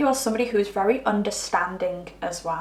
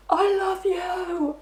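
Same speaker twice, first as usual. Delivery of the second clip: high, wailing sobbed cry, much higher and drawn out slowly; mock bawling; 10 semitones higher, slower than usual. Partner bawling a confession to the client, mock wail. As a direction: ham it up like a sobbing lover. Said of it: "they start bawling".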